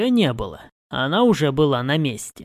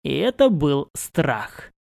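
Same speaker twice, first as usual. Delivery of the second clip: usual speaking voice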